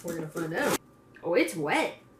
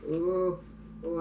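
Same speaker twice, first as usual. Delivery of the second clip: ordinary talk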